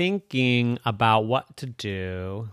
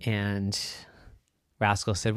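ordinary delivery, nothing marked

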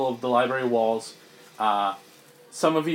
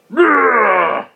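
a loud, high, hammed-up "Bruh!" from the monster; monster shout; 9 semitones higher, 10 dB louder than usual. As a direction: bellow it like a brute. GM voices Boltmon yelling.

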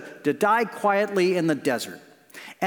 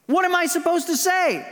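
pitched way up, pleading; Moses panicking; 9 semitones higher than usual. Retold or imitated. Moses fretting to God about Pharaoh, pitched high. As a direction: squeak out the question in worry. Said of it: "And Moses goes".

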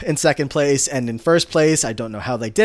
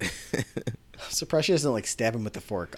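whispered aside; 2 semitones lower, 7 dB quieter than usual. Real, not acted. Host jokes that Sue could use the fork, whispering.

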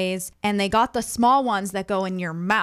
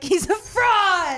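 mock accusation; 10 semitones higher, much slower than usual. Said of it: "She's like".

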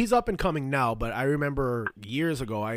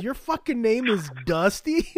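laughing retelling; 7 semitones higher, 3 dB louder than usual. Retold or imitated. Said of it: "He goes".

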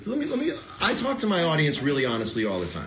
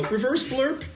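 bright high-pitched mock reset call; reverse blurp; 11 semitones higher, slower than usual. Shown as a demonstration. Comedian to his guest, a high playful reverse blurp. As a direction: chirp it like an undo button. Showing how to recover from a bad joke.

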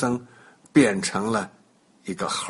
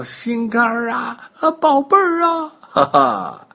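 put-on high, overjoyed calling to the child; joyful cry; 7 semitones higher than usual. A narrator's reading. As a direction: parents hugging their lost child, crying out with joy. 父母亲着孩子叫. (爸妈抱着孩子，高声欢喜地喊)

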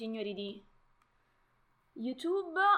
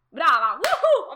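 cheering; 5 semitones higher, 13 dB louder, slower than usual. Real, not acted. Ha indovinato, esulta.

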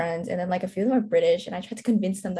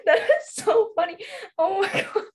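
laughing; 10 semitones higher, 4 dB louder than usual. Real, not acted. After laughing at TikTok joke.

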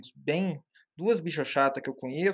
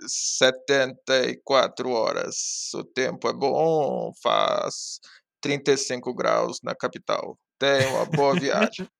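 slow, low, drawn-out pilot voice; airline pilot announcement; 4 semitones lower, much slower than usual. Shown as a demonstration. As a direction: announce it like a bored captain. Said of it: "todo piloto de avião é uma coisa mais ou menos assim".

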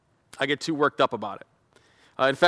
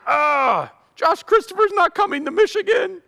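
loud, high anguished ugh; hammed-up sports heartbreak; groaning dismay; 13 semitones higher, 4 dB louder than usual. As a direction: wail like a crushed fan at three a.m. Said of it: "first thing that hit my mind".

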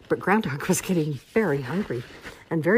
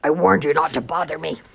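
louder grumpy bear; growled warning; 6 dB louder than usual. A narrator's reading. Bear warning off Groundhog, loud growl. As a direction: growl it firmly like a bothered bear. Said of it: "he growled".

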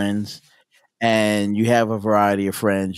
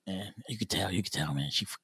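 low, hushed buddy voice; imitating Joey; 4 semitones lower, 16 dB quieter, faster than usual. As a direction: play the cocksure friend, low and under the breath. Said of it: "he used to always walk around and say".

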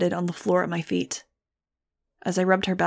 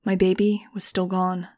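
usual speaking voice

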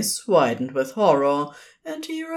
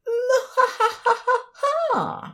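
high, cackling villain laugh; evil supervillain laugh. Acted. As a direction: throw the head back cackling. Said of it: "an evil supervillain kind of laugh".

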